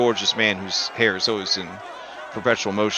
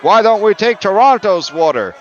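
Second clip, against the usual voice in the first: a touch higher, louder eager heckle; crowd voice; 8 semitones higher, 8 dB louder than usual. Acted. Protester calling out to the orator, lightly higher and louder. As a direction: call out the idea from the crowd. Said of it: "some of them are like".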